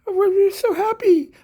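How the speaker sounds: high, sweet little-brother cat voice, slowed; a kitten gushing over his big sister; squeaky cat brother, pleased and eager; voicing the cat brother. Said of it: "one of her brothers ... is going".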